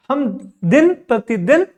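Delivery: normal talking voice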